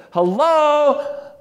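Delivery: loud, high, drawn-out hello; calling out hello. Acted. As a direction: holler it to get inattentive people's attention. Mock call to inattentive officials.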